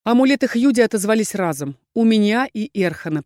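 ordinary delivery

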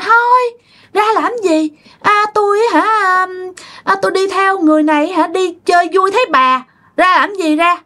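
high, whiny-stubborn ghost voice; stubborn spirit, refusing. Retold or imitated. Spirit refuses the exorcist's order to leave, high and stubborn.

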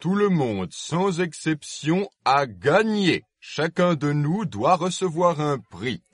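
lightly voiced proud dodo. A narrator's reading. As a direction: declare it like a judge. Dodo proclaims everyone won.

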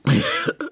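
a sudden high-pitched laugh; squeaky high laugh. Acted. Kommissar lacht beim Schnaps. (kurzes hohes Auflachen)

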